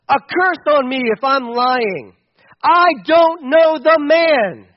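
loud, slow cursing denial; desperate oath. Acted. Peter curses himself, denying.

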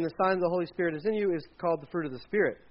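ordinary delivery

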